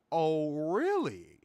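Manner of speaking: slow, drawn-out intrigued reaction. Retold or imitated. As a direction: stretch it out as if sizing up an opportunity. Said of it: "I was like".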